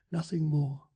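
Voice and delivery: ordinary delivery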